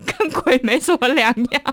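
high, loud, half-laughing rush; excited laughing gossip. Retold or imitated. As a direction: blurt it out laughing. Excited retelling to roommates.

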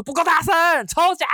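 very high MC shout. Retold or imitated. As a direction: yell it to fire up the room. Host pushing crowd to shout louder.

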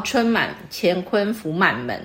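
reads each syllable slowly and evenly, like reciting verse; slow couplet recitation. Shown as a demonstration. Teacher reciting a couplet example to the student, slow and measured. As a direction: savour the couplet one word at a time. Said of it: "然后".

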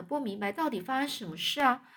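ordinary delivery

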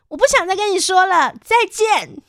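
high, loud, prim haughty goodbye. Retold or imitated. Retelling it to listeners, a high, loud, haughty goodbye. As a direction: play the proud, prickly one. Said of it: "很傲娇的跟他说".

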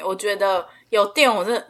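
normal talking voice

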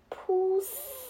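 high, soft 'pssss' with a long hiss; drawn-out, playful whooshing sound effect; imitated peeing sound. A narrator's reading. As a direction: voice a long hissing trickle.

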